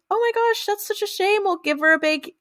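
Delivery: high-pitched imitation of a concerned neighbor; mock neighborly sympathy, lifted up high; sympathetic neighbor.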